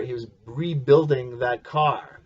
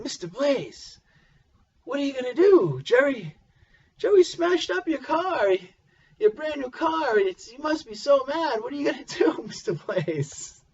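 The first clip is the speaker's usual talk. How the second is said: much higher, put-on kid voice; kid's voice; 11 semitones higher than usual. Neighbor boy asking the father about his smashed car, high mock kid voice. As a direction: imitate a pestering kid, pitched way up.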